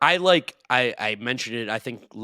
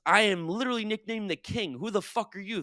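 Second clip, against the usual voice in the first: mad LeBron; 10 semitones higher than usual. Retold or imitated. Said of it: "LeBron's like".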